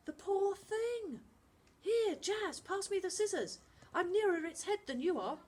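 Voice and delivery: put-on a boy soothing and directing at once; gentle boy voice. Charlie to Jazz and Finn, high and caring. Charlie takes over the rescue.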